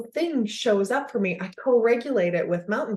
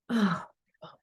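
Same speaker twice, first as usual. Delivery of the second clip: empathetic parent; 3 dB quieter than usual. Retold or imitated. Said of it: "you can look at your kid and be like".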